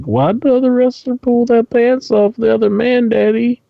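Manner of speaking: put-on high, childlike questioning voice; curious kid voice. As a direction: ask like a puzzled little kid. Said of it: "I'd hate to have to explain that to my kid".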